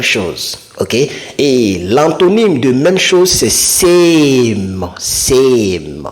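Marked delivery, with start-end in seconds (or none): 3.5-6.1 SAME with a long, stretched A; drawn-out same. Shown as a demonstration. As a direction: pull on the vowel in "same". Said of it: "quand je tire sur le same".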